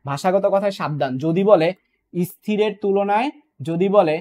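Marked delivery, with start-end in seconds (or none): 2.1-3.3 slow, drawn-out phrase with a rising end; careful wording. Shown as a demonstration. Teacher warning students about exam wording, careful and slow. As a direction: stretch it out carefully, lift the end.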